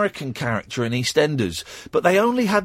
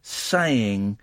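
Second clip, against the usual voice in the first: ordinary talk, nothing marked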